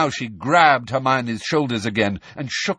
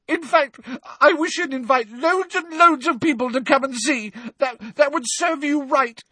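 high, loud tearful outburst; sobbing, vengeful; 4 dB louder than usual. A narrator's reading. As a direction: spit it through sobs. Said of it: "now sobbing into her hands again".

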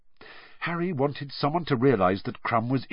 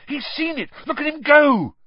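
high cry building to a loud shout; excited shouting; 13 semitones higher, 4 dB louder than usual. A narrator's reading. Harry watching the seeker dive, shouting excitedly. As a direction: cheer him on, pitched high. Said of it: "Harry shouted".

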